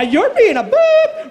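slow, high squeaked bleep; indignant retort. Retold or imitated. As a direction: fling the insult back, stretching it. Acted quarrel, insult thrown back.

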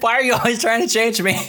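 high, hammed-up victim whine; mock whining. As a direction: whine it as a put-on grievance. Repeats the mock excuse.

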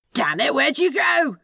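high-pitched, contained yell; panicked yell. A narrator's reading. Demon fleeing the Roomba, yelling for the angel. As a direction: yell out in alarm on the run. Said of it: "Crowley yelled as he sprinted".

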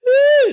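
high, loud cry of praise; praise shout. Real, not acted. Preacher shouting praise in the middle of prayer, loud. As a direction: burst out with a joyful shout.

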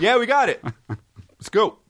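high-pitched mock sound guy; high, put-on crew-member voice with a laugh. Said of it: "Sound guy goes like this".

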